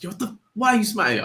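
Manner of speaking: put-on peeved questioning; annoyed wife. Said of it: "she gets pissed off over anything".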